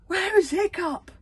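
high, loud, slow shout; drawn-out, urgent shouted question; shouted demand. A narrator's reading. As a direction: call out desperately for a lost son. Father demands his missing son.

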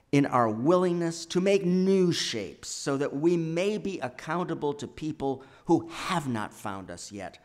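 ordinary talk, nothing marked

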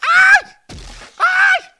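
very high, loud yelp; shrill scream. Real, not acted. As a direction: scream at the hit. Grita reagindo ao golpe. (grito agudo)

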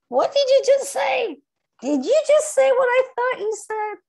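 high-pitched mock indignation; incredulous friend. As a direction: play the shocked friend calling you out. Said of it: "they say".

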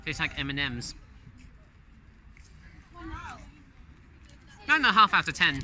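talking with food in mouth; chewing candy. Real, not acted. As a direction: mouth partly full. Seen 0: Holds bitten bar; lips pursed, cheeks working after bites at -7, -5.

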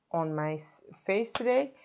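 ordinary talk

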